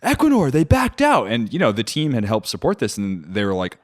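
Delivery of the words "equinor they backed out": much higher, thrilled; excited announcement. Retold or imitated. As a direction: burst out with the news to the team. Announcing the news to the office.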